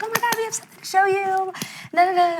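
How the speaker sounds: high, fast, gushing excitement; thrilled therapist. Retold or imitated. Therapist bursting to show mom the surprise, high and fast. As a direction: squeal it out like an overjoyed therapist. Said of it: "and she's so happy".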